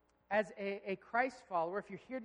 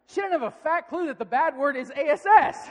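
high, very loud incredulity; big, raised-voice punchline; loud amused exclamation; 6 semitones higher, 14 dB louder than usual. Real, not acted. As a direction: belt it out in amused disbelief. Exclaims at daughter's innocence.

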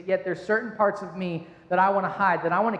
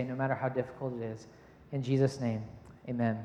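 quiet, low opening prayer; hushed church prayer; 7 semitones lower, 4 dB quieter than usual. Acted. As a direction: pray softly to God.

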